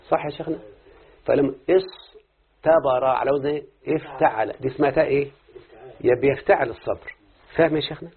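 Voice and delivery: normal talking voice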